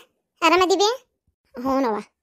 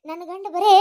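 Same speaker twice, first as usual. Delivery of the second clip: normal talking voice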